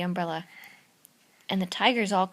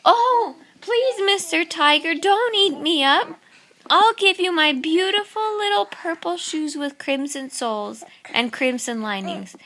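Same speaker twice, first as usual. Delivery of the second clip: put-on high, pleading child's voice, louder; pleading little boy; 7 semitones higher, 5 dB louder than usual. Little Babaji begging the tiger, high pleading voice. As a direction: beg the tiger sweetly in a high voice.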